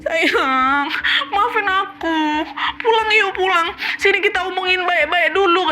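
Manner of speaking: high, tearful pleading woman; high voice of a weeping wife; crying wife voice. Acted. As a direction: beg through tears to come home. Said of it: "Tenangis-nangis istri aku".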